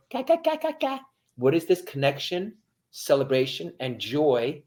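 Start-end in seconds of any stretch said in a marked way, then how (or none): 0.1-1.0 very high babbling; baby babbling. Retold or imitated. Baby answering praise, high babble. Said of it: "the baby goes".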